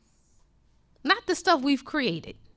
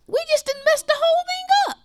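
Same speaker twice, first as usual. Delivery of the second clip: high, loud preaching cry; full-force pulpit preaching; impassioned preaching; 12 semitones higher, 5 dB louder than usual. Acted. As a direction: preach it out with rising fervor.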